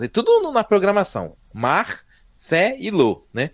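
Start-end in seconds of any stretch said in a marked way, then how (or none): 1.5-3.3 "Mar, Cé e Lô" very slow, one syllable at a time; separated syllables. Shown as a demonstration. Host showing how the program split Marcelo, "Mar, Cé e Lô" syllable by syllable. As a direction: set "Mar", "Cé" and "Lô" apart with pauses. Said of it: "ele separava as sílabas".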